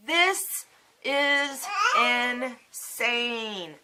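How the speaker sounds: "This is insane" extremely slow, each word drawn out; word-by-word emphasis. Retold or imitated. Patient repeating her disbelief, drawn out word by word. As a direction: hold each word long, stretching "insane" most.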